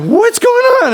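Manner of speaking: high frightened squeak; scared. Retold or imitated. Boy hearing noises in the dark, squeaking high in fear. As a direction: shoot up high in panic.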